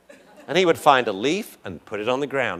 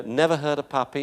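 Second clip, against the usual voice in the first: ordinary talk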